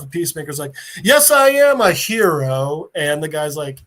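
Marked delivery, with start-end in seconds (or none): 1.0-2.9 loud, slow, self-important declaration; hammed-up heroic boast; proud proclamation. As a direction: announce it grandly, savouring every word. Flattered hero proclaims himself.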